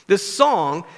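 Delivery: ordinary talk, nothing marked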